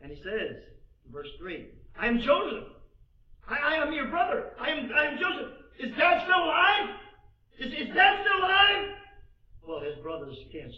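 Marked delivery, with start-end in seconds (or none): 2.0-8.9 loud, high, overwrought outburst; crying out. Acted. Joseph revealing himself to his brothers, crying out loud. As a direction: cry it out at full voice. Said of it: "Now he's crying out loud".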